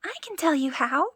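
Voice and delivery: high, loud little shout; high cry. Acted. Tom Thumb calls to the thieves, high and loud. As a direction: call up from below, high. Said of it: "cried Tom Thumb".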